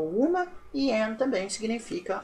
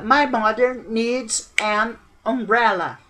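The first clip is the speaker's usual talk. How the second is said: exemplo lento; 5 dB louder, much slower than usual. Shown as a demonstration. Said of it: "Outra aí, ó".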